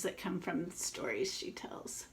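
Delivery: trailing into a soft whisper. Real, not acted. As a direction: drop to a whisper as you show the page. Aside to kids while showing pictures.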